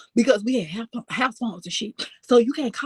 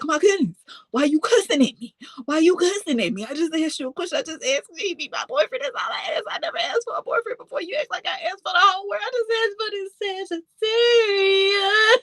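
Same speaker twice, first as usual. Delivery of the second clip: retold sobbing; 8 semitones higher than usual. Daughter pleading to father on phone, sobbing. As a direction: wail it like a heartbroken kid. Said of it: "I am cry me a river".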